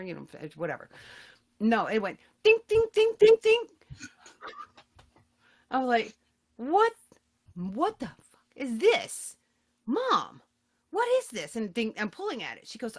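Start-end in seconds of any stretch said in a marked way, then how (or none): 2.4-3.6 high, loud ringing dings; ping each one out like a plucked wire; comic sound effect, high and punchy; springy twang. Retold or imitated. Imitates plucking the springy hair. 6.6-11.6 high-pitched, drawn-out disbelief; mock horror at a discovery; aghast. Her shocked question to mother retold.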